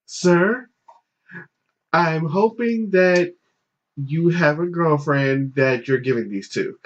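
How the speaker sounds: high, slow, deliberate imitation; concerned girl clerk. Clerk questions man buying pads, high measured imitation. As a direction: draw it out carefully, starting high with pauses. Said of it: "And she was just like".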